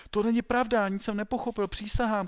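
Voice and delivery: loud, high panicked denial; shouting. A narrator's reading. Pupil swearing he understood nothing, shouting. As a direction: cry out at the top of the voice. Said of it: "křičel".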